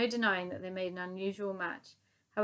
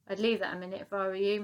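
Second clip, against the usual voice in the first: usual speaking voice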